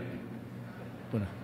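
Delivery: breathless whisper, very slow; the mayor mimics a nervous whisper in court; whispered aside. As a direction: hiss it under your breath like trouble is coming. Whispered aside, witness caught.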